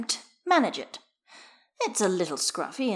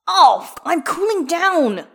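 slow, high, drawn-out wail of protest; loud protest; 10 semitones higher, 11 dB louder, slower than usual. A narrator's reading. Skater protests being pushed into splits, wailing. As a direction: protest loudly as you get pushed down. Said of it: "Gale protests as he hits".

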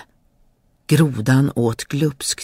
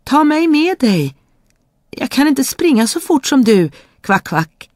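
high, pleading frog cry; a high frog cry calling after the princess; 11 semitones higher, 4 dB louder than usual. Acted. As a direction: beg her to wait, high up. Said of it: "ropade grodan".

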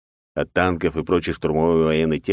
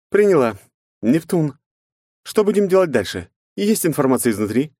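female character voice; 9 semitones higher, 3 dB louder than usual. Acted. Knight voiced, urgent.

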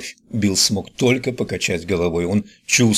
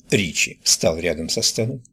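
plain reading voice

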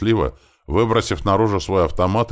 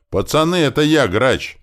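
high, loud shout to friends; raised voice, pitched well up; yelling; 11 semitones higher, 7 dB louder than usual. Acted. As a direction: yell it out from a distance. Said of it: "вновь заорал он от машин".

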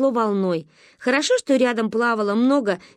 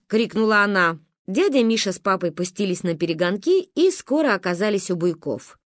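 plain reading voice

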